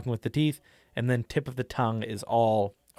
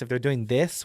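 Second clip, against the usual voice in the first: normal talking voice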